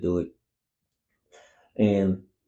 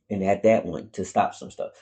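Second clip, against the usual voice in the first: ordinary delivery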